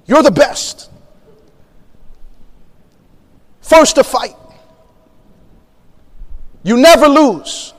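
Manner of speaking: lightly imitated general rousing troops; short punchy phrases with long pauses; rousing speech. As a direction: give a mild retold pep speech to recruits. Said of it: "he's giving this rousing speech".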